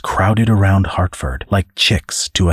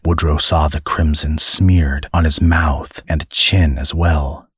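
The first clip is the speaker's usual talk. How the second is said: usual speaking voice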